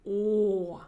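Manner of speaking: О with lips pushed far forward; exaggerated rounded o. Shown as a demonstration. Teacher shows students exaggerated О, lips rounded. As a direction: protrude the lips hard on О. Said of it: "So protrude your lips".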